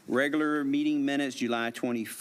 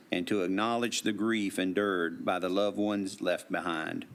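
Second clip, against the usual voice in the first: measured, official proclamation read; steady ceremonial reading voice; formal proclamation; 3 semitones lower than usual. Acted. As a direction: read it out like a town decree. Reads a formal proclamation aloud.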